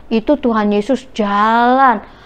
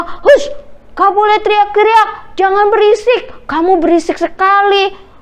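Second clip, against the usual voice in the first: high-pitched acted telling-off; crowd's annoyed reprimand, pitched up; scolding hush; 8 semitones higher than usual. A narrator's reading. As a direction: shush a noisy nuisance crossly. Crowd scolds the shouting man.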